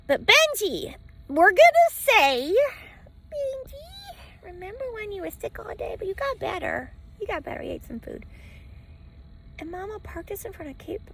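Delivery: high sing-song pet voice. Real, not acted. To Benji after his sick day, high baby talk. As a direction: coo it to the dog. Talking sweetly to the dog.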